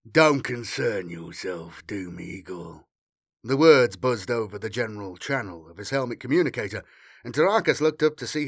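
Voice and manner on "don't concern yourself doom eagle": slow, low, drawn-out warning; menacing rival officer. A narrator's reading. Flesh Tearer dismissing a Doom Eagle, menacing. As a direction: warn him off slowly in a deep voice. Rival captain warns off over radio.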